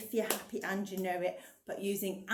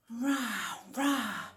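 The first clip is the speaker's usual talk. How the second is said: a light roar, louder on the last; lion roar; 5 dB louder, much slower than usual. Acted. Reading the lion's line to children, a lightly voiced roar. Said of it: "said the lion".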